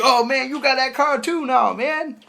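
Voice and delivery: mock whining, pitched well up; pestering guy. Guy badgering him about the card, high mock complaint. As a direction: imitate a pest, high-voiced.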